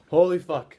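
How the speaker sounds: loud, awed disbelief; first stunned gasp of disbelief; stunned exclamation. Real, not acted. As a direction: blurt it out in shock. Stunned after the title win.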